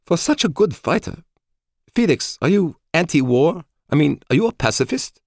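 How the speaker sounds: high-pitched, puzzled woman's voice; bewildered woman. A narrator's reading. Woman asking Felix whether he's a pacifist, bewildered. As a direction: question him in a high, incredulous character voice. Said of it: "She was completely bewildered".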